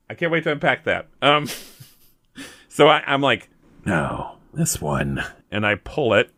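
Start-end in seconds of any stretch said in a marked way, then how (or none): 3.8-5.2 low mock voice. Player voicing his character picking the book, low. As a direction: voice dropped low and deep.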